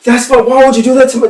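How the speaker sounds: high, loud, fast cry of betrayal; anguished yelling. Acted. Anakin yells at Nixie over his brother, loud and fast. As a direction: yell it out, beside yourself over a brother. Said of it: "yelling, like".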